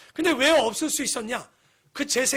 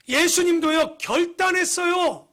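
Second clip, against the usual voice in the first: 예수님도요 said louder and higher, pressed hard; emphatic preaching; 5 semitones higher, 5 dB louder, slower than usual. Acted. Pastor repeats the point to the congregation, loud and emphatic. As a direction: hit 예수님도요 like a pulpit cry.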